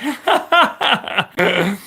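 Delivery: high burst of laughter; bright laugh. Real, not acted. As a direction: let out a real laugh. Laughing at own remark.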